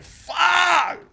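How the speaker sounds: high loud yelled curse; shout. Retold or imitated. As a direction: yell it in dismay. Kid reacting.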